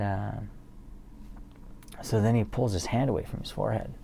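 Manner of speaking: usual speaking voice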